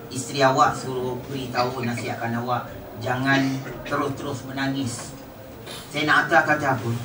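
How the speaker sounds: ordinary talk, nothing marked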